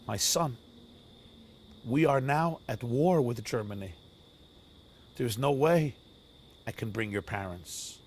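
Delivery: low, soft, gently breaking bad news; compassionate king. King to the crying refugee boy, gently compassionate. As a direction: speak softly and low, with fatherly compassion. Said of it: "with eyes of compassion".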